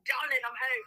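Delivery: high-pitched mock Cockney; hammed-up Cockney greeting; Cockney accent. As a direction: squeak it out as a put-on Cockney husband. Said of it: "like, Cockney".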